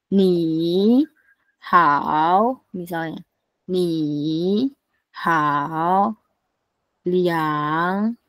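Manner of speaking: each syllable dipping down then rising again; slow falling-rising third tones; third tone demo. Shown as a demonstration. Teacher modelling third tone on Ni, hao, liang, ye. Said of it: "turun, habis itu naik lagi".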